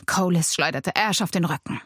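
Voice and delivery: ordinary delivery, nothing marked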